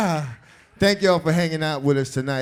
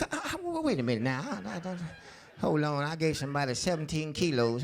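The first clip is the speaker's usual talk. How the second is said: muttering, much softer, lost in thought; hushed, panicky old-man fumbling; confused old gangster; 4 semitones higher, 6 dB quieter than usual. Pretend. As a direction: play a forgetful old dealer searching his memory.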